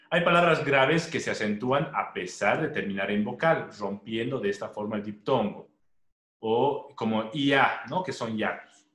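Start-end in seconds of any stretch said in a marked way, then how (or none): none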